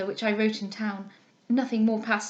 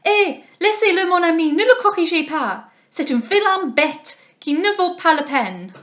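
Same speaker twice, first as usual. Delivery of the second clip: much higher, much louder shrill French call, slowing at the end; Madame Duval calling out; 7 semitones higher, 9 dB louder than usual. A narrator's reading. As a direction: call out to her friend in scornful French. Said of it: "Madame Duval called out".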